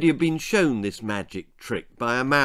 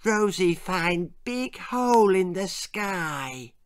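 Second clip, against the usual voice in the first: put-on higher, slow little child; toddler voice; 6 semitones higher, slower than usual. Rosie tells her father what she found, slow toddler voice. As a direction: speak it like a small wondering toddler. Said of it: "said Rosie".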